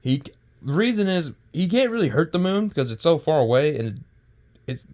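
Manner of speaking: usual speaking voice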